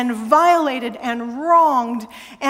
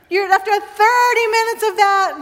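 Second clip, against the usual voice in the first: after thirty minutes of that restarted higher and louder; higher, louder retake of the line; amused restart; 6 semitones higher, 3 dB louder than usual. Shown as a demonstration. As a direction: pick it up again over the laughter, brighter. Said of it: "stop laughing".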